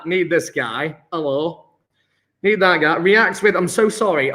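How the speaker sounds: ordinary talk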